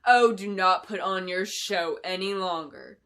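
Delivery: slow, loud, cold snap; loud deliberate scornful retort; snapping. Acted. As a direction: bite off each word with contempt. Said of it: "Clarice snapped back".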